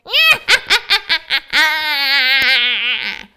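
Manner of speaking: loud evil cackle; horrible wizard laugh. Acted. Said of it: "he laughed his horrible laugh".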